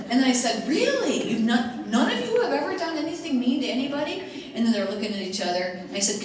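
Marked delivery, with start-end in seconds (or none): 0.7-4.2 high, loud mock disbelief; incredulous. Speaker to kids claiming they're never mean, loud high incredulity. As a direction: question it as if wildly unconvinced. Teacher disbelieving the kids' denial.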